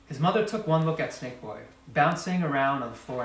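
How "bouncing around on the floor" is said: usual speaking voice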